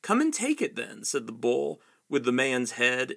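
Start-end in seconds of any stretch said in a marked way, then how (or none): none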